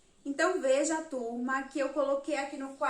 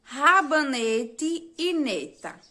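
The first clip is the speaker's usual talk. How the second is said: each word said slowly and clearly; teacher introducing words, slowly; slow, clear word presentation; 4 dB louder, slower than usual. Shown as a demonstration. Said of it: "eu escolhi essas duas palavras".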